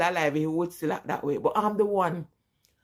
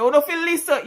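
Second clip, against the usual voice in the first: imitated scolding dad; 8 semitones higher, 6 dB louder than usual. Acting out dad scolding during beating.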